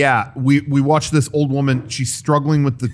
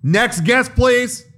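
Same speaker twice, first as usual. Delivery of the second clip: higher, impatient cashier call; clipped snippy cashier; 8 semitones higher, much slower than usual. Retold or imitated. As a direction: sing it out like a fed-up cashier. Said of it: "Hey, they're calling you".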